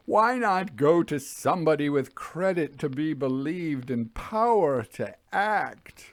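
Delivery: a tormented lonely man begging to know why he was warned; troubled signalman crying out for an answer; anguished signalman. Acted. Signalman voiced in read story.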